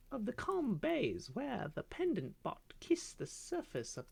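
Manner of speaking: high, longing little bird voice; a caged songbird pining for home; wistful canary. Acted. Canary reminding the parrot of their home, high and longing.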